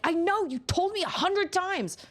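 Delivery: put-on high, drawn-out childish exasperation; annoyed kid. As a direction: sigh it like a fed-up kid. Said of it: "she'll be like".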